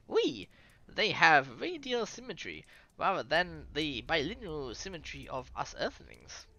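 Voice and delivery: put-on scientist explaining in a French accent. Explaining primordial ooze to listeners, French accent. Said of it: "Here's a French voice coming".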